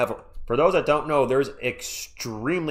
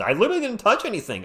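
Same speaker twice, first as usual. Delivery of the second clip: exasperated; 8 semitones higher, faster than usual. Real, not acted. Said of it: "I was not doing impressions".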